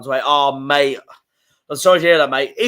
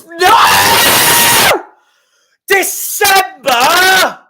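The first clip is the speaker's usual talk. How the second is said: much higher, loud anguished yell; furious shout; 9 dB louder, much slower than usual. Real, not acted. Fan raging at the fixture date, loud furious yell. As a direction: scream it out, drawing December out slowly.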